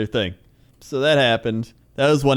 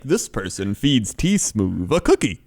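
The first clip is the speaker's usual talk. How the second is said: slow, lower, weird narrator voice; creepy narrator impression; 5 semitones lower than usual. Showing how the narrator said it, weird and creepy. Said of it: "It was the weirdest and creepy".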